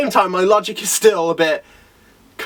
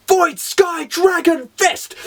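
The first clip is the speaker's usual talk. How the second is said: high, slow, drawn-out anime move call; attack-name battle cry; 9 semitones higher, much slower than usual. Acted. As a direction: announce the special move dramatically. Calls out the fighter's attack name.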